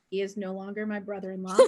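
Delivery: quick, low, soft aside; wry aside. Real, not acted. Storyteller interrupting herself about an ex brother-in-law, wry aside. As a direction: drop it under your breath as a comic footnote.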